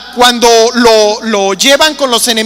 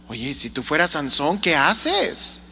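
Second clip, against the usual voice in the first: quiet aside; 10 semitones lower, 11 dB quieter than usual. Real, not acted. Pregunta íntima al público.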